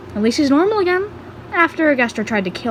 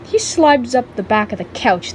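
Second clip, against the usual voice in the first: ordinary delivery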